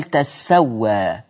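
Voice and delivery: "تسوى" said slowly as tas-sa-wa; تشديد السين. Shown as a demonstration. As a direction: lean on the doubled sin of "تسوى". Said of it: "ليه ورش قال". ("تسوى" بفتح التاء وتشديد السين)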